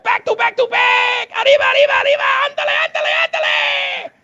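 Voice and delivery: very high, shouted mock Mexican radio host accent; hammed-up Mexican DJ accent yelling with glee; over-the-top fake Mexican accent, screaming. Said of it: "doing this super racist Mexican voice".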